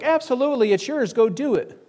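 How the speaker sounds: lightly hammed-up eager yes-man; eager yes-men. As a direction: gush it like a flatterer who wants to live. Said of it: "all the prophets were like".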